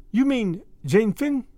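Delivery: slowly, puzzled, with a French lilt; startled French girl. A narrator's reading. The maid hears the name, slow and wondering.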